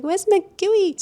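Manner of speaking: mock call for Magui, high pitched. Fan calling for Magui on TV, high and playful. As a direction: sing out the name way up high. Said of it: "I'm like".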